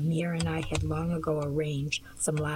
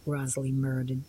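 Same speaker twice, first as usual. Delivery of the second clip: plain reading voice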